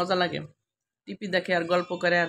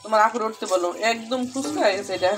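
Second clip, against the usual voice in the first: raised, louder, delighted calling out; louder excited call across the house; 4 semitones higher, 7 dB louder than usual. Real, not acted. Excited mother calling family over.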